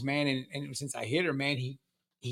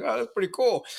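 higher, slightly louder, impressed "oh"; mildly amazed aside; 8 semitones higher, 5 dB louder than usual. Retold or imitated. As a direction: react like you just saw a movie trick. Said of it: "I'm like".

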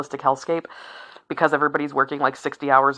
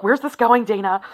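mock puzzled listener; 7 semitones higher than usual. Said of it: "you're like".

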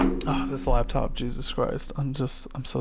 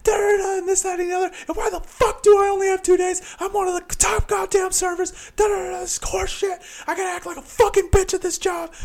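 very high reenacted rant; angry, near tears. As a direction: squeal out a retold furious protest, trailing into filler syllables. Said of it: "literally so angry, I'm almost in fucking tears".

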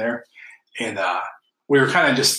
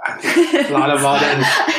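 laughing; 2 semitones higher, 5 dB louder, much slower than usual. Real, not acted. Drawn-out repeat, laughing.

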